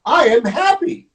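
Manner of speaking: high, lifted happy announcement; happy declaration. Acted. Storyteller voices Gerald reassuring Piggy, happily. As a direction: declare it with childlike gladness.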